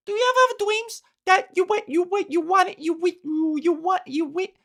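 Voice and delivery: put-on high, fast childish stutter; imitating the kid, rushing and tripping. Quoting the kid in the video, high and stuttering. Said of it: "the kid being like".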